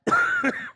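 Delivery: rough hacking cough; coughing fit. Real, not acted. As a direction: hack through a cough. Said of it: "Sorry, folks".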